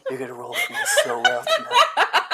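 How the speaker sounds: high, laughing coo at the dice; coaxing voice. Pretend. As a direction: sweet-talk the dice. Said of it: "Uh, all right, kid".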